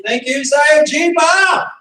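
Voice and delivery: slow, loud, high shout-out; calling out thanks with gusto; called-out thanks. Real, not acted. Instructor shouts thanks to a teacher, slow, high and loud.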